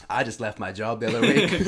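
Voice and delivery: laughing through the words; can't hold back a laugh while telling it; words spilling out mid-laugh. Real, not acted. Retelling own reply, laughing.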